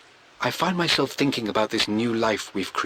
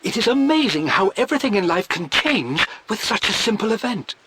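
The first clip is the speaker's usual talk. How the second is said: beam with joy at the news; bursting with pride; 9 semitones higher than usual. Acted. Father-to-be writing of the coming child, swelling with pride. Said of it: "I am bursting with pride".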